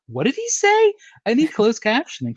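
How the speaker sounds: high, loud, incredulous retelling; baffled outburst. Retold or imitated. Fan reacting to Kenny McLean talking, baffled. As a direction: blurt it in exaggerated bafflement.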